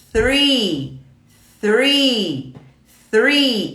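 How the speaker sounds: "three" said slowly with the th, three times; repeating three. Shown as a demonstration. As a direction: drill "three" with the th. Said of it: "Eu tô te expondo a esse som".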